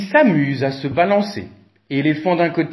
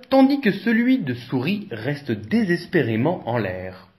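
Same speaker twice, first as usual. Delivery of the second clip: ordinary delivery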